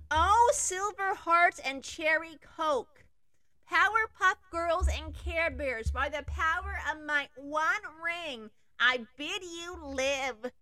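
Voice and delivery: high, slow, hammed-up spell chant; mock incantation. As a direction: intone the spell like a ditzy girl. Girl casts spell again.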